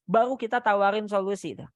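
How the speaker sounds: ordinary delivery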